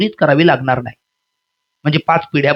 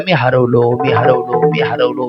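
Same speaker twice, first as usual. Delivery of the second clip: lower, wailing मी हरवलो; mock wailing; 6 semitones lower, slower than usual. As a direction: wail like a lost simpleton. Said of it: "तो मधी बोंबलायला लागला". (गंगारामचा रडका आक्रोश)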